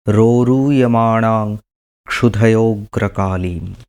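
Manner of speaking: measured, resonant mantra recitation on a level pitch; chant-like Sanskrit prayer; Sanskrit invocation. Acted.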